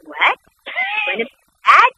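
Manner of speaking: high, loud squeal of surprise; shocked delight. Retold or imitated. Daughter reacting to her dad's news, high and delighted. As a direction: squeak it out, thrilled by the gossip. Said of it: "and I went".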